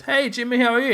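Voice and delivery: bright, high, loud call to a celebrity; high-pitched retold greeting; fan greeting. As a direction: call out eagerly to someone famous.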